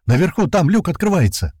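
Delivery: high-pitched urgent cry; alarmed yell. A narrator's reading. Lookout reporting an opening hatch, alarmed yell. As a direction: raise the alarm at the sight. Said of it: "заорал".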